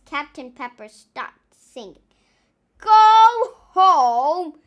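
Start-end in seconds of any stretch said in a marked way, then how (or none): 2.8-4.6 loud, high furious roar. Acted. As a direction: roar it in outrage. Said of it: "he roared".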